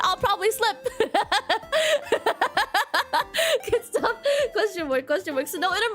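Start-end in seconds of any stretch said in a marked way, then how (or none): 0.9-3.7 long high giggle. Real, not acted. Streamer giggling at herself for slipping while talking to chat. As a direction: break into a long giggling laugh. Laughing at her own slip.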